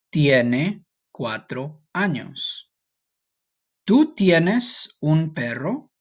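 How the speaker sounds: slow, careful Spanish for learners to repeat; each word spaced out so the class can follow; nice and slow. Shown as a demonstration. Teacher to students repeating after her, slow and clear. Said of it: "I'll talk nice and slow".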